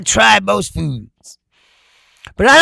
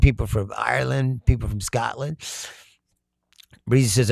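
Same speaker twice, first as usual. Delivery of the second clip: ordinary talk, nothing marked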